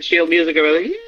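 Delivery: ordinary talk, nothing marked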